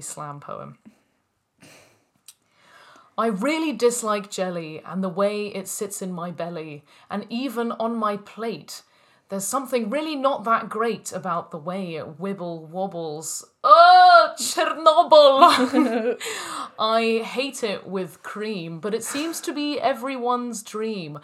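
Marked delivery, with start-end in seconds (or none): 3.2-13.4 rhythmic, emphatic performance poetry; slam poetry. Acted. Performing a jelly slam poem for friends, rhythmic and emphatic. 13.6-16.2 mock exclamation. Mock outburst within poem. 16.5-21.2 rhythmic, emphatic performance poetry; slam poetry. Acted. Performing a jelly slam poem for friends, rhythmic and emphatic.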